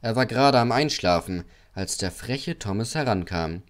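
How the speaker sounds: usual speaking voice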